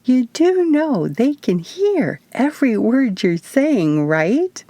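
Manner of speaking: high, drawn-out teasing stage whisper; mock whisper with a hint of giggle; giggly whisper. A narrator's reading. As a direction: tease in a slow, high, lightly hushed voice. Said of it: "Izzy whispered back with a giggle".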